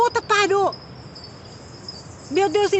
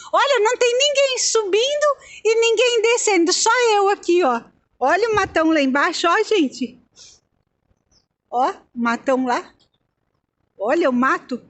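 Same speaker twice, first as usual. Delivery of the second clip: ordinary talk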